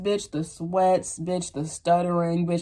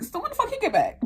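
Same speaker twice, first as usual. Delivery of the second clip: incredulous inner voice; 13 semitones higher, faster than usual. Retold or imitated. Inner shock.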